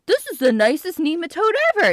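high, loud goofy simpleton voice; dopey cartoon enthusiasm; sweet dumb idiot. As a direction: gush like a lovable dimwit. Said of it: "the dumb idiot ... the sweet dumb idiot".

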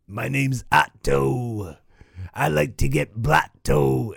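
slow, drawled stoner bus-driver voice; lazy slurred party-dude delivery; Otto impression. Doing a Simpsons voice for a cohost, slow stoner drawl. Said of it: "It wasn't the best, but it was decent".

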